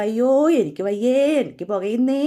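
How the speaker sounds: hammed-up whining cry; mock wailing. Alok running off with stinging eyes, mock wailing. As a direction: wail it like a burnt child, half in jest. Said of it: "കരഞ്ഞു കൂവിക്കൊണ്ടാ ഓടുന്നത്".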